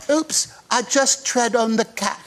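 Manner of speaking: put-on deadpan child's oops, drawn out; little daughter. Daughter interrupting the family video, slow imitated child voice. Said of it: "your daughter said".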